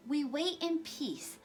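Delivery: hushed, slow, awed reading; breathless whisper. A narrator's reading. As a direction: barely whisper it in awe. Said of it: "barely able to whisper".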